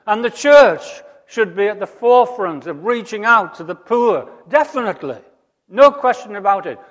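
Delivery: high, loud, impassioned preaching; preaching at full force. Acted. Preacher rousing the congregation about the poor, impassioned and loud. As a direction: lift the congregation, ringing and urgent. Preaching climax on good news.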